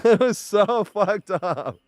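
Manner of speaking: can't stop laughing while saying it. Real, not acted. Laughing at trombone memory.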